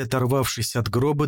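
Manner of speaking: ordinary delivery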